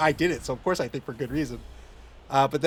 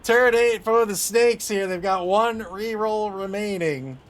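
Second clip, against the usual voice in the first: louder sports caster call; announcer; 4 semitones higher, 5 dB louder, slower than usual. Acted. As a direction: call the turn like a commentator. Announcer style turn call.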